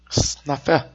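lightly voiced Puerto Rican mail lady; imitated Puerto Rican accent. Mail lady answers the greeting about J-Lo, imitated Puerto Rican accent. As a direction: softly mimic her Puerto Rican accent. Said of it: "that's how she talk".

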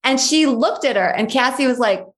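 ordinary delivery

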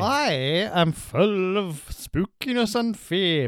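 mock mysterious. Mock spooky slow.

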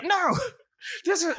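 high laughing Rocco voice; mock Rocco whine. Podcast host imitating Rocco's protest, high and laughing. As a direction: squeak it out as Rocco.

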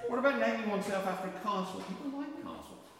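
high, loud king with long-A "castle"; acted royal voice. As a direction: pitch it up like a pleased monarch.

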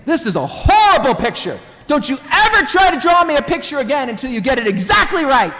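high, loud furious scolding; angry yelling father. Acted. Dad yells at daughter over her scribbled picture, angry. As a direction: tear into a child at full volume, acting it out. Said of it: "yelled at the daughter saying".

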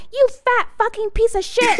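high, snarling mock elf insult; zombie elf cussing. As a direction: spit it out like a foul-mouthed zombie elf.

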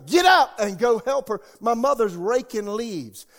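high, loud urgent shout; the inner voice telling him to help his mother, shouted; shouted command. Retold or imitated. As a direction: bellow it as a heavenly order. Retelling the Spirit's command.

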